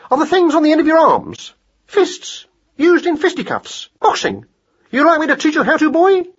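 very high, lively hare voice; boxing-coach hare. Acted. Hare offers mouse boxing lessons, high and lively.

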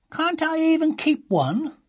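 put-on high, slow little girl's plea; pleading child. A narrator's reading. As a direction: beg like a small girl.

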